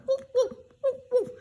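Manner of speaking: very high yappy woof; storyteller imitating a small dog's barks; dog barking. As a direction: yap it out like a little dog. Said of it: "And the dog went".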